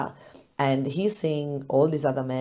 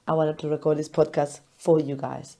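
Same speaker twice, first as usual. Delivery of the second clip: usual speaking voice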